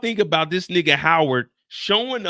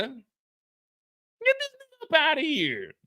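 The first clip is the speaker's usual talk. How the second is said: slow dismissive drawl; 8 semitones higher, much slower than usual. Retold or imitated. Mock dismissal.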